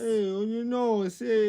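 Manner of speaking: high, hesitant French caricature; hammed-up Parisian hemming and hawing; mock French accent. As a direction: stumble along as a high-voiced Frenchman. Mock Parisian shrugging off complaints.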